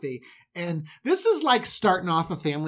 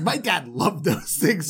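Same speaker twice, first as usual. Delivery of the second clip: ordinary talk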